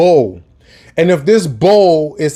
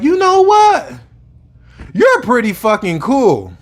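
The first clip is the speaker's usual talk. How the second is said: put-on sudden high-pitched girl's voice on you know what; mock a young woman's aha moment; imitating young woman; 7 semitones higher than usual. Retelling a coworker's compliment, high imitating voice. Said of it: "she was like".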